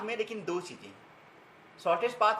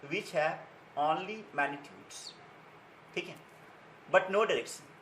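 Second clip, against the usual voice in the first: very slow, spaced teacher dictation with long pauses; 2 semitones lower, 4 dB quieter, much slower than usual. Acted. Teacher dictating the scalar definition to students, word by word, very slowly. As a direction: read it out slowly so students can write.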